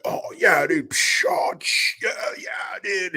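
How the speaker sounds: breathy unvoiced surfer dude rising to a mock shout; mock stoner surfer drawling then yelling; surfer dude impression. Streamer imitating a teen surfer from a video, a hammed-up surfer dude. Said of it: "he really wasn't speaking English".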